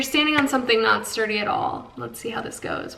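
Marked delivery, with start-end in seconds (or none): 2.0-3.0 nervous soft whisper. Real, not acted. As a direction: hush it under your breath. Nervous on wobbly footing.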